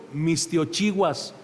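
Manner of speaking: Mistiochiguas lento y separado en sílabas; pronunciación náhuatl. Shown as a demonstration. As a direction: repite Mistiochiguas muy despacio. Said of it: "No sé si yo la diga correcta". (el pastor repite Mistiochiguas con cuidado, lento)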